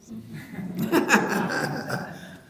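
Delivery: hearty, drawn-out laugh. Real, not acted. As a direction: laugh it out after the joke. Laughs after his sung example. (खुलकर लंबी हँसी)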